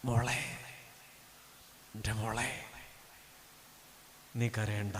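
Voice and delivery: low, soft and gentle; tender comfort. Acted. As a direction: hush a crying daughter. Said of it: "സ്വർഗം പറഞ്ഞൊരു മറുപടി ഉണ്ട്".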